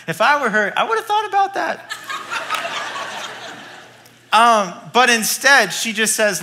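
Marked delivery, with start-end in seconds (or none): 2.0-3.8 airy laugh. Real, not acted. Laughing at own joke.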